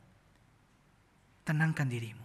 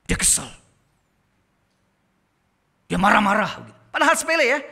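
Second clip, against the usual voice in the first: mock annoyed parent; 4 semitones higher, 10 dB louder than usual. Preacher acts out angry parent.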